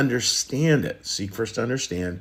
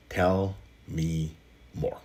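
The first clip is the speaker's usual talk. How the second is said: slow soft delivery; 6 semitones lower, 6 dB quieter, much slower than usual. Shown as a demonstration. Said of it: "those three words are".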